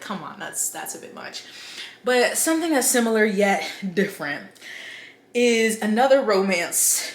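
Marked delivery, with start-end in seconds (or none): 0.0-1.4 softer, fading to a whisper; muttered under breath. Retold or imitated. Booktuber recalling her reaction, muttered under breath. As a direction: mutter it to yourself, trailing off. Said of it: "but I was just like".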